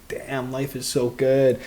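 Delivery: drawn out slowly, relishing each word; slow savoring. Retold or imitated. Fighter recalls his morning routine, slowly relishing it. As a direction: let it linger like a contented sigh. Inner voice savoring simple things.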